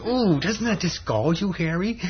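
high, goading cartoon tempter; devil's voice. Devil goading Harry as the neighbour comes, devil's voice. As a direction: rub it in, pitched high. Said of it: "the devil goes up to".

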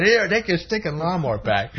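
high, hammed-up cartoon anger; fuming mad. Harry smashing up the borrowed lawnmower, fuming mad. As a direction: spit it out as mock fury. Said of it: "now Harry's all fuming mad".

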